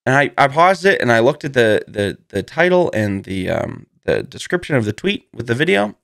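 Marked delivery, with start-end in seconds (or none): none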